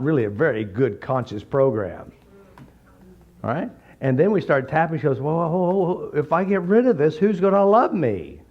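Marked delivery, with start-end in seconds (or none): 5.2-8.4 higher hesitant whine, stalling; imitating a client who clings to her illness; whiny client. Client hesitates to the therapist, mock whiny voice.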